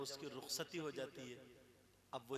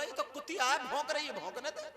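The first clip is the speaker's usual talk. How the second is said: much higher and louder, laughing scorn; mocking dismissal; 10 semitones higher, 6 dB louder than usual. Husband mocking his mother to his wife, laughing and loud. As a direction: sneer at the mother through a laugh. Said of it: "अम्मा की तरफ वो इशारा करके बोला".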